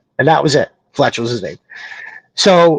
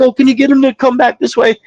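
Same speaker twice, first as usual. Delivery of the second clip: high-pitched call after a runaway dog; mildly mocked yell across the yard; retold yell; 9 semitones higher than usual. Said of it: "the lady had to yell".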